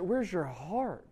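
slow, searching question; pleading preacher, slow; slow heartfelt question. Real, not acted. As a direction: let it sink in, slowly. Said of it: "preacher asks the crowd".

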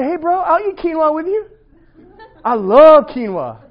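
high, loud, slow hyped-up bro; mock eager friend. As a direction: play an overeager bro selling quinoa. Voicing a good friend.

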